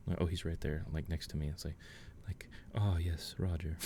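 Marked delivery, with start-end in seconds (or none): 2.7-3.8 soft, mock-contented pet owner; playful admiring murmur. Player imagining petting Roger in the chair, softly mock-admiring. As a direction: lightly play at fondly admiring a pet.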